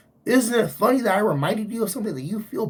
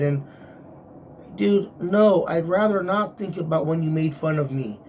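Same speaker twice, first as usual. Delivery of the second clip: ordinary delivery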